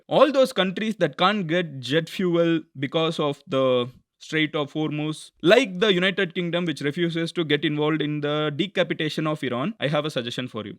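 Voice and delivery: very slow, deliberate English reading; slow tweet read-out. Acted. Commentator slowly reading a president's tweet to viewers. Reads Trump's tweet aloud.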